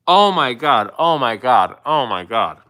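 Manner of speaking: loud shocked gasps of disbelief, fading each time; overwhelmed, the shock slowly draining; loud stunned exclamations that soften; stunned oh my god. Real, not acted. Stunned by a viewer's gift.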